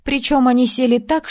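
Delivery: ordinary delivery, nothing marked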